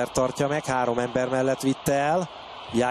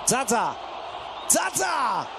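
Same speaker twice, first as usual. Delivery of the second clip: repeated rising shout; excited name shout; 6 semitones higher, slower than usual. Real, not acted. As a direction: call the name twice, higher. Chance called live.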